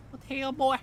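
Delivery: squeaky mock outrage; high goofy bro voice; silly clown.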